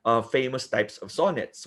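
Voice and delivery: normal talking voice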